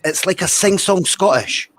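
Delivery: high, slow sing-song Scottish accent; sing-song posh Glasgow. Shown as a demonstration. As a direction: stretch it out in a posh Glasgow lilt. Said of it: "their own whole posh voice".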